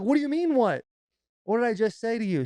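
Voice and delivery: worked-up parent demanding an answer; exasperated dad. Retold or imitated. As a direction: scold a kid in disbelief. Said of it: "put some bass in the voice".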